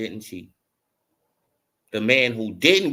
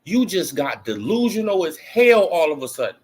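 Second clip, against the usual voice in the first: incredulous; 6 semitones higher than usual. Retold or imitated. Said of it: "You be like".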